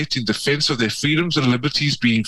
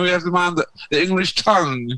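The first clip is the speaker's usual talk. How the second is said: high, hammed-up English accent; mock-English accent, playfully overdone; put-on English accent; 6 semitones higher than usual. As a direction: do a bad English accent, pitched up. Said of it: "That's a terrible accent".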